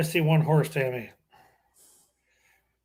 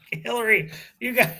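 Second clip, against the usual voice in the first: amused, high and laughing; 7 semitones higher than usual. Real, not acted. Laughing at a picture.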